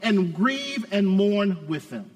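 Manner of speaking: high, emphatic pulpit exhortation; full-force preaching. Real, not acted.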